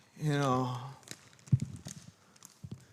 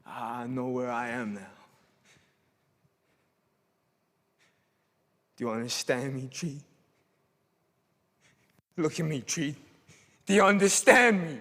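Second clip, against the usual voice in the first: angry dressing down; 5 semitones lower, 4 dB quieter than usual. Acted. Confronting Treat, low and firm.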